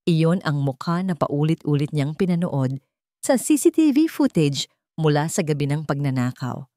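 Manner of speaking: ordinary delivery, nothing marked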